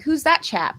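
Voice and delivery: jokey posh English "Who's that chap?"; hammed-up British accent asking; mock British accent. Casters joking about the player, mock British accent. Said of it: "we're done with that accent".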